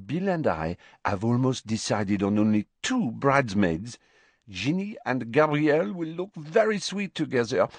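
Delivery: put-on lilting French-accented young bride. A narrator's reading. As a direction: hold forth in a French accent about your own plans. Fleur, French character, chatting of wedding.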